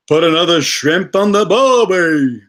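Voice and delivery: hammed-up bad Australian accent, loud and high; Jim Carrey. Streamer quotes a comedy to show his bad British accent, loud mock Australian. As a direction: belt it out in a broad fake Australian accent. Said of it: "Jim Carrey in Dumb and Dumber".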